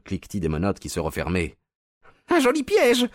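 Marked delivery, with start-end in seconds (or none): 2.3-3.1 very high, loud head voice; falsetto cry. A narrator's reading. Trapped villain crying out at the arrest, falsetto. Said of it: "cria la voix de Fossé".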